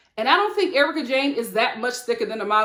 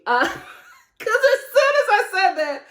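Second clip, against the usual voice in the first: real high-pitched giggling through the words; laughing; 9 semitones higher, 3 dB louder than usual. Real, not acted. Speaker cracking up at her own remark, laughing. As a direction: talk through a fit of laughter.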